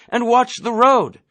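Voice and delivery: high loud yell; Nately yelling at the driver to watch the road, loud; shouting. Acted. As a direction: shout the warning. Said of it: "Nately shouted".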